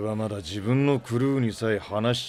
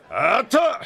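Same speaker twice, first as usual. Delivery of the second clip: higher and louder burst; exasperated outburst; 12 semitones higher, 6 dB louder, slower than usual. Acted. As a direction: throw up your hands. Captain gives in, exasperated.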